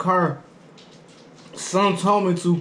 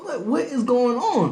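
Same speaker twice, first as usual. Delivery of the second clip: confused; 7 semitones higher, slower than usual. Retold or imitated. Reacting to rude salesman.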